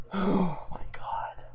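whispered in disbelief, slow; whispered real disbelief; exasperated whisper. Real, not acted. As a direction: breathe it out, done with this. Exasperated after bad story.

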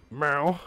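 short imitated meow, rising; a quick questioning meow; cat meow. Cat answers the player's greeting, short rising meow.

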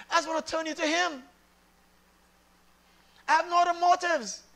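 loud, high declarations with drawn emphasis; insistent pulpit declaration; emphatic preaching. Real, not acted. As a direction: preach it with rising force. Preaches at full force.

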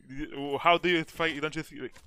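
usual speaking voice

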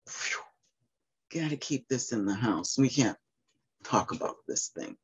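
dropped to a near whisper; hushed secrecy. Retold or imitated. Mimics family hushing it up.

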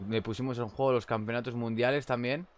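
ordinary talk

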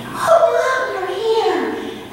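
put-on high, bright happy kid greeting; a sick boy suddenly glad to see mom; cheerful boy. Sick boy greeting his mother, high and delighted.

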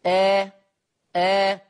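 sustained, level open آه, no tilt towards e; natural fatha. Shown as a demonstration. Teacher demonstrating the jaw's natural path, a plain open آه. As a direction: open آه with the jaw lifted. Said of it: "بفتح الفك لأعلى".

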